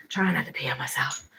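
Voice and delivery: soft unvoiced whisper to herself. Real, not acted. Muttering to herself while desperate for the bathroom, whispered. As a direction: breathe it out under her breath. Repeats line, then whispers it.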